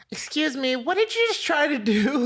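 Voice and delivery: high-pitched imitation of an indignant woman; angry woman driver. Storyteller imitates the driver confronting him, high mock indignation. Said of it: "Then I hear ... Like that".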